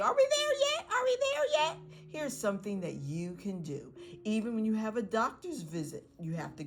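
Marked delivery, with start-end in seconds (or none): 0.0-1.8 put-on high, loud kid pestering on a car ride; impatient child. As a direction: imitate a child nagging from the back seat. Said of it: "Instead of them keep asking you".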